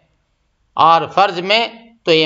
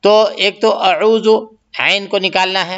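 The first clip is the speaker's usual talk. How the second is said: ordinary delivery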